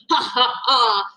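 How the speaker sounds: mock-evil gloating dinosaur. A narrator's reading. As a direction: gloat over the trap. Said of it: "a dinosaur gloating".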